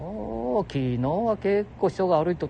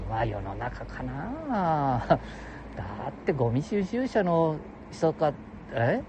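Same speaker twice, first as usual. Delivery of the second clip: ordinary talk, nothing marked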